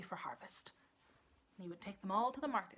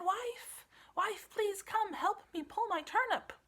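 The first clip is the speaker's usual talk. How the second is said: high, loud call across the farm; 11 semitones higher, 6 dB louder than usual. Acted. Farmer calling his wife from across the farm, loud. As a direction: call out to someone far off.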